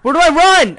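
high, loud frantic cry; panicked yell. Real, not acted. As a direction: shout in terror. Chased by monsters.

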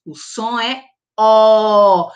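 slow "o som é O" ending on a loud open O; open O sound. Shown as a demonstration. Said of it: "na palavra óculos".

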